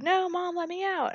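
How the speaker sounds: high, soft mock plea; mock pleading. Trapped boy begging his mom, mock pleading. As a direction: plead like a trapped child, lightly imitated.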